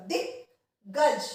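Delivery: दिग्गज said very slowly, syllable by syllable, half ग joined to दि; drawn-out teaching demo of दिग्गज. Shown as a demonstration. Teacher slowly models दिग्गज for students to repeat. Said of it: "द को छोटी की मात्रा आधा ग".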